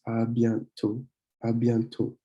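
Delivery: "à bientôt" ending on a pure O, no T; silent last T. Shown as a demonstration. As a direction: model French "à bientôt" with the final T dropped. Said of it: "don't pronounce the last T".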